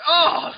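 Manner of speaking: high, loud mock "oh!"; sudden yelp of mock shock; startled yelp. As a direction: imitate a startled cry. Retold reaction in imagined scene.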